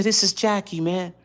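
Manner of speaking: high, light pleading imitation. Caller insisting who he is, high imitation. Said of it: "this is just how he sounded".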